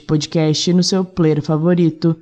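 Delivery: bright friendly podcast host; cheerful podcast outro. Real, not acted.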